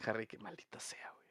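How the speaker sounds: susurrado sin voz; susurro. Real, not acted. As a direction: maldice entre dientes, susurrando. (susurra frustrado por Harry)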